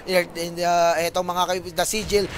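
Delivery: normal talking voice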